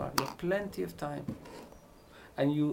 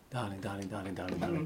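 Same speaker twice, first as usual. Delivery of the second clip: mock repetition; 6 semitones lower, 7 dB quieter than usual. Shown as a demonstration. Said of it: "Doesn't make sense".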